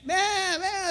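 high bleating sheep imitation; panicked sheep. Pastor imitating sheep that panic when the shepherd vanishes, high bleats.

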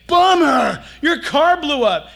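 loud, high dude-style "bummer" outburst; mock friends' yell. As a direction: imitate friends hollering the bad news. Friends react to your bad news.

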